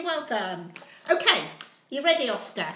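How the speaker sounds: ordinary delivery, nothing marked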